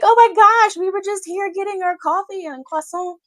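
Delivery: high, loud mock panic; panicked French tourist. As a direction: squeal it like a frightened tourist.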